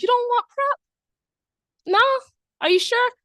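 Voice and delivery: high, loud, incredulous pleading; pushy scientist voice. Acted. Implementation scientist pressing a reluctant person to take prep, high and incredulous. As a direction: push hard, disbelieving they'd refuse. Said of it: "We as implementation scientists are like".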